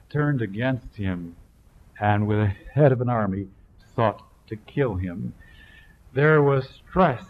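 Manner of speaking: fervent preaching. Acted. Preacher warning the congregation, voice loud and driving. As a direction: preach it out with weight.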